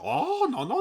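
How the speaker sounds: very high squeaky mock protest; a high, frantic mock denial; mock panicked no. Mocking Chinese platforms deleting criticism.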